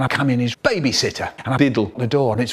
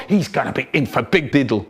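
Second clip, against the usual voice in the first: plain reading voice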